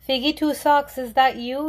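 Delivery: high, hopeful call. A narrator's reading. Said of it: "he called".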